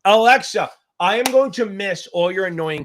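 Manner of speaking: calling Alexa across the room, loud; a loud, half-joking call to Alexa. Real, not acted. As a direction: call out to the speaker. Calling the smart speaker.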